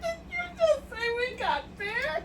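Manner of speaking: high, squeaky laughing question; cracking up in disbelief; real helpless laughter. Real, not acted. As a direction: barely get the question out through laughter. Cracking up at misheard phrase.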